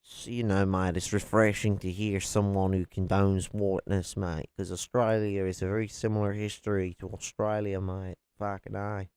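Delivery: hammed-up Aussie mate-speak; broad mock-Australian accent; bad Australian accent. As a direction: do a cringey, over-the-top Australian. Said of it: "shit Australian accent".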